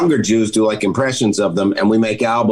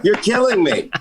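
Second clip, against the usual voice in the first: much higher, hammed-up cry of pain; mock yell; 12 semitones higher than usual. Husband to wife during their game, a hammed-up high cry. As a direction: squeal it like a pretend victim. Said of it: "I was yelling".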